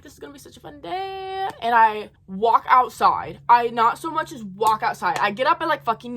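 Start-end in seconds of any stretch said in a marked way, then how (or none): 0.0-1.5 soft, high-pitched mock excitement; hyped-up imitation. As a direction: hype the day up in a small, high voice. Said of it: "hyping everybody up and I'm like".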